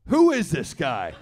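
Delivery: loud stunned wonder at Stu; amazed. Retold or imitated. Stripper kisses Stu, amazed.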